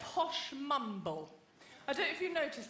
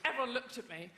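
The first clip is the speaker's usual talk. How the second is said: ordinary talk, nothing marked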